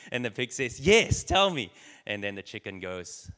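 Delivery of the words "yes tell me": pitched-up, louder eager reply; can't wait to hear it; eager pig. Acted. Pig eagerly begging the chicken to tell him, excited.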